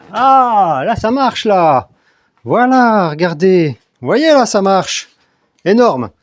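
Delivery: loud, high burst of excitement; excited triumph. Real, not acted. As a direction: exclaim that it finally works. The test suddenly works on camera.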